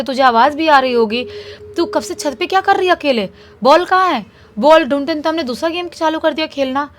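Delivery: loud, high angry scolding; scolding yell. Retold or imitated. Brother scolding Shruti for hiding on the roof, loud. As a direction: tell her off sharply, fast at the start. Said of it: "चिल्लाता है इसको, श्रुति को ज़ोर-ज़ोर से".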